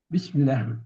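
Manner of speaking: normal talking voice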